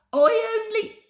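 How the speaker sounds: very high, broken-off plea; faltering cut off. A narrator's reading. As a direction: start an excuse and get cut off. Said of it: "faltered Bert".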